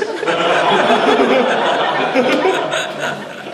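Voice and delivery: real laugh at his own hammy voice. Real, not acted. Teacher laughing at his own impression. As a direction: burst out laughing. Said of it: "And I was five years old".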